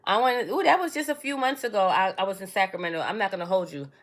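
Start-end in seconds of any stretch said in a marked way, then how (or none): none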